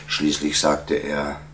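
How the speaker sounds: usual speaking voice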